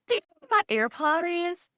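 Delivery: high-pitched imitation of a daughter; daughter's voice. Daughter at the door asking mother, high imitated voice. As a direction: pitch it way up as a needy kid. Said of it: "She's like".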